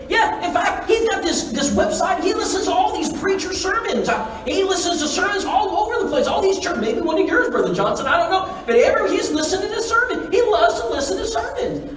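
high-pitched, breathless-with-news retelling; excited news. Retold or imitated. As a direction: gush about an amazing discovery. Caller excitedly reports brother's secret listening.

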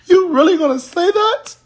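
high squeaky mock voice of the hater. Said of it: "They said".

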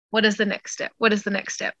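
soft, steady repetition; quiet repeated mantra. Shown as a demonstration. Vlogger repeating her calming question, soft mantra. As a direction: murmur it to yourself. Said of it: "too overwhelming".

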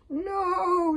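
high, loud dragon whine; scared dragon. Acted. Toothless balking at the witch's hole, scared squeal. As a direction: squeal a frightened refusal.

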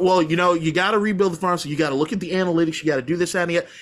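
a hint of a smug analytics guy; mock analytics talk. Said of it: "everybody wants to talk about".

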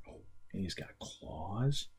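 normal talking voice

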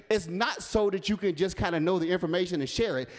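ordinary delivery

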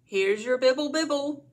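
hint of cheery baby-talk call; dad to toddler. A narrator's reading. Dad to Chuck serving toast, lightly voiced loud baby talk.

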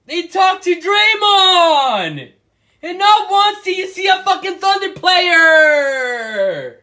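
outraged fan yelling at the screen; angry shout. Real, not acted. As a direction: shout it out like the snub is personal. Ranting about ESPN snubbing Thunder.

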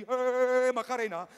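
loud, high yelp of Macarena; shouted Macarena. Retold or imitated. The woman yells Macarena as her hair gets pulled, loud.

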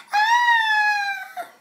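long, very high shriek; babyish scream. A narrator's reading. Girl startled from behind, long high babyish scream. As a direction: scream like a frightened baby. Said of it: "screamed like a baby".

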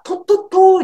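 "トットトー" chirped high and loud like a hen's cluck; chicken-like British sound. Retold or imitated. Teacher mimicking how British English sounded to her, high clucking. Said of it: "鶏の鳴き声かと思った".

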